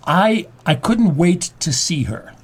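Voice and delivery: "couldn't" leaned on, slow and drawn out; stress on couldn't. Shown as a demonstration. Host showing how stressing "couldn't" changes the line. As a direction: hit "couldn't" hard and slow. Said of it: "which word you emphasize".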